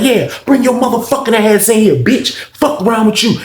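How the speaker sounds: high, heated mock challenge; acted angry dare. Officer on the phone daring the inmate, angry.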